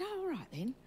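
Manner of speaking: lightly acted high-pitched okay; high-pitched agreement. Agreeing to friend's church invitation, high-pitched. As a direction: go up high for a casual retold agreement.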